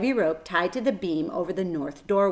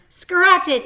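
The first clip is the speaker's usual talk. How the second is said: put-on much higher, loud boy yelling; yelled; 11 semitones higher, 10 dB louder than usual. A narrator's reading. Avery yells to Fern from the swing, loud. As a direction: holler it as you fly past. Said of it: "yelled Avery".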